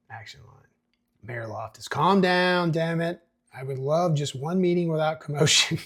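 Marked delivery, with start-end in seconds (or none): 1.9-5.8 raised, higher exasperated voice; acted exasperation. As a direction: call the meeting to order, fed up. Mayor quelling commotion in script read.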